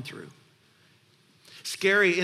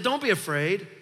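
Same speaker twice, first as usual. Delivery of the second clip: proclaiming; 5 semitones higher, 5 dB louder than usual. Acted. Preacher proclaiming God's words.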